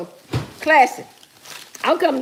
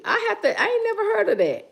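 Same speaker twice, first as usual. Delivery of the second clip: high, quick amazed remark; incredulous; 9 semitones higher, 3 dB quieter, faster than usual. Real, not acted. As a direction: sound truly baffled. Never heard of corn pudding.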